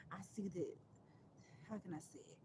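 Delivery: unvoiced whisper to herself; whispered, searching for the word; whispered aside. Real, not acted. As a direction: breathe it out as a private aside.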